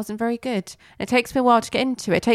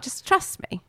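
Bernard voice; 11 semitones higher, 4 dB quieter than usual. Retold or imitated. Bernard voiced, much higher.

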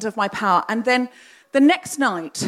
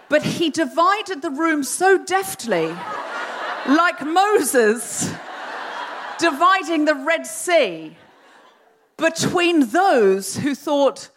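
drawn out with laughter, higher; slow it down through a giggle; laughing, slowed; 6 semitones higher, much slower than usual. Real, not acted. Comic Moses comparison.

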